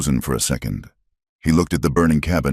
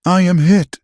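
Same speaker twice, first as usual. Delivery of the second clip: gasped; 6 dB louder than usual. Acted. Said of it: "she gasped".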